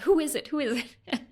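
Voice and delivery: high, loud eager questions, then settling; excited. Retold or imitated. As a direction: imitate the friend bursting with excitement. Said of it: "she was so excited".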